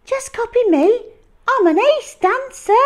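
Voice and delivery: very high, loud showing-off; boastful dinosaur voice. Acted. Dinosaur inviting dancing, high and boastful. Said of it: "said the Super Swooper".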